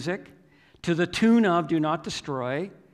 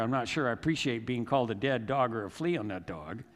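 ordinary talk

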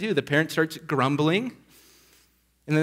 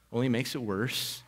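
normal talking voice

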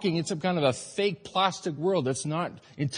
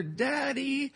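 put-on much higher, soft child's voice; little girl calling Daddy; 8 semitones higher, 6 dB quieter than usual. Father recalling his daughter Sarah calling, high soft child voice. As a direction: call softly like a small girl waking her dad. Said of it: "when I hear".

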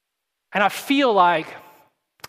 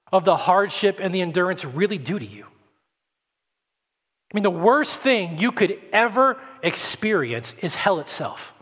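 normal talking voice

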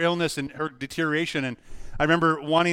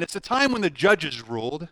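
usual speaking voice

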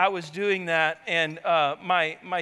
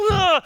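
loud, high groan of defeat; dismayed groan; 8 semitones higher, 5 dB louder than usual. Retold or imitated. Dad seeing the kids awake, loud defeated groan. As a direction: sag at being caught awake. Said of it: "And I'm like".

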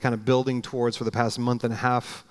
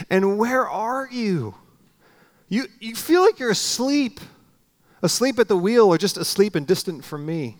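high, loud pleading cry to God; desperate plea; 6 semitones higher, 3 dB louder than usual. Acted. Believer crying out to God in a storm, high desperate plea. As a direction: plead up high like the Lord abandoned you.

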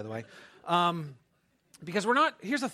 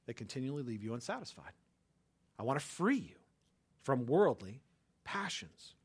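softer, tender words from God; gentle voice of God; 7 semitones lower, 7 dB quieter than usual. Retold or imitated. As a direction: say it softly, as God inviting. Voicing God's words, soft.

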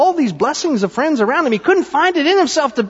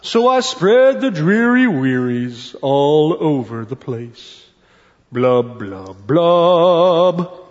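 gloomy drawn-out storybook refrain; glum pout-pout fish; 9 semitones lower, much slower than usual. Acted. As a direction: draw out each blub like a sulky fish.

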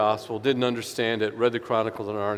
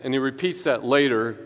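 ordinary talk

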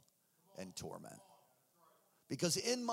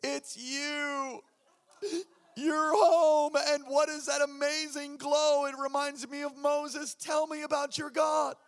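lightly voiced wife, high and choked up, then louder; imagined tearful wife; 7 semitones higher, 5 dB louder, slower than usual. Imagined wife greeting husband at door, choked-up and joyful. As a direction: play the overjoyed wife welcoming him home. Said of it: "and she's gonna be like".

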